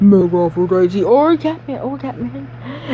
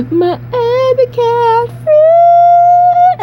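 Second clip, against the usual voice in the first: much higher and louder, slow squeal; ear-splitting squeal; 6 dB louder, much slower than usual. Pretend. Gamer squealing over his new epic cat so loud he apologizes. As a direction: screech it out, drawn long.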